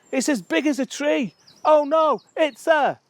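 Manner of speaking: high, loud panicked cry; hammed-up terror. Someone scared of a bumblebee, hammed-up terror. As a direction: wail the poem in mock panic. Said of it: "someone who's scared of one".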